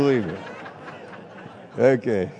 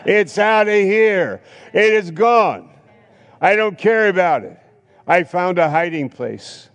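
high, loud gleeful boast; jubilant, loud and high; triumphant glee; 7 semitones higher, 5 dB louder than usual. Retold or imitated. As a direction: gloat as you dump the bottles. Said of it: "laughing at my".

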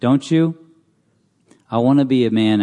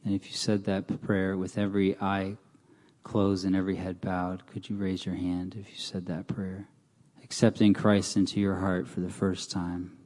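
softer, gentle invitation to receive Christ; altar call; 2 semitones lower, 7 dB quieter than usual. Acted. Preacher invites listeners to receive Christ, soft and pleading. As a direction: speak gently, inviting the lost forward.

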